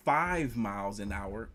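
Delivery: normal talking voice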